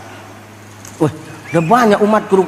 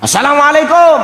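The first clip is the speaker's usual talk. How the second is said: calling out; 7 semitones higher, 4 dB louder than usual. Acted. Calling at the gate of hell.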